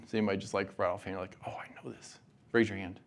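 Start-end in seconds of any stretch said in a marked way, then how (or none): 1.5-2.2 breathy pretend answer, whispered; mock whisper. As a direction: whisper it like an eager student. Imagined student blurting answer.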